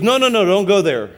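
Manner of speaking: sharp refusal. Retold or imitated. Seatmate stopping pastor quoting the Bible, loud refusal. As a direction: cut him off firmly.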